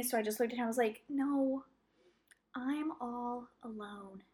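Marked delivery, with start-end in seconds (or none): 1.1-4.2 slow, soft poor-me act; pitiful plea. Retold or imitated. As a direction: play helpless for sympathy, drawn out. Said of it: "get him to feel bad for me".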